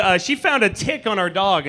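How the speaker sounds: ordinary delivery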